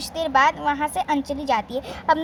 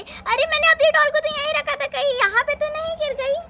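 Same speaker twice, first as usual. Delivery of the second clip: put-on high, alarmed child's voice searching for her doll; a startled little girl, pitched up; surprised; 8 semitones higher than usual. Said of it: "देख के हैरान हो जाती है". (हैरान बच्ची की ऊँची आवाज़)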